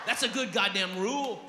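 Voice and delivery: ordinary delivery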